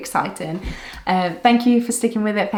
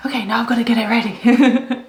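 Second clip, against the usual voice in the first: whispered; 3 semitones higher, 5 dB louder than usual. Pretend. Imagined stressed self-talk.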